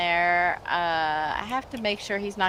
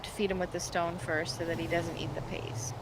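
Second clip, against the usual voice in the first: whisper; 2 semitones lower, 9 dB quieter than usual. Real, not acted. Repeating the rule in a whisper.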